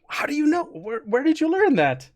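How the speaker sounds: high-pitched, astonished questions; amazed to hear it, pitch up; eyes lighting up. Acted. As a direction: ask with delighted surprise, voice lifted. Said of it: "eyes light up".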